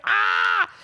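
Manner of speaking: mock pain yell. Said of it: "And he's like".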